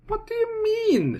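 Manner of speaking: high falsetto female voice; woman's falsetto. Acted. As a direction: squeak it out like a lady. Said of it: "she responds".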